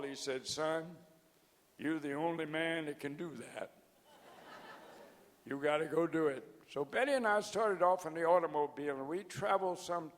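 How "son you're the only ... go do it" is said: a hint of a Southern drawl, a little softer; lightly imitating a Texan friend's Southern drawl. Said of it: "Tom says to me in his drawl".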